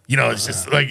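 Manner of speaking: usual speaking voice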